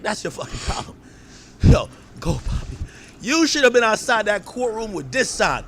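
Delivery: usual speaking voice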